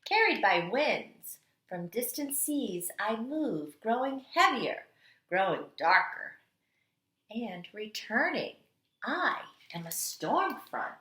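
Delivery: storytelling read-aloud. Acted. Teacher reading The Water Dance to kindergarteners, dramatic storytelling. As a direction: read it like the water itself is dancing. Reads picture book aloud.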